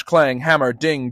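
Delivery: usual speaking voice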